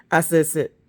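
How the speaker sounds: loud, firm sit; firm command. Retold or imitated. Owner commanding the dog firmly and loudly. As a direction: tell the dog, no suggestion. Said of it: "not like".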